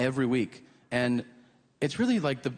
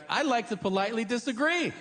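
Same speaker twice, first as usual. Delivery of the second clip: mock polite; 7 semitones higher than usual. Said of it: "interrupt and be like".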